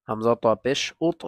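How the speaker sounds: ordinary talk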